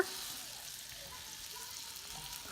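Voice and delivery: high breathy gasp of delight. Real, not acted. Finding all four fit the pan, gasping with delight. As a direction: gasp at the small victory.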